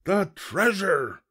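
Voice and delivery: slow, high pirate outcry; booming crew. A narrator's reading. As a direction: boom it out like an alarmed crew. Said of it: "boomed the crew".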